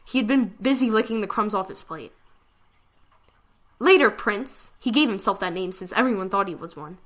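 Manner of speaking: plain reading voice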